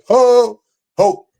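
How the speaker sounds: quick, high bursts of Ho; shorter holler. Shown as a demonstration. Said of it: "shorter hoe".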